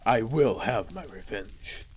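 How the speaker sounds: low, slow dramatic vow; vengeful hero. Acted. Hero swears revenge on the dragon, low slow menace. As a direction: drop deep and draw it out, fading off.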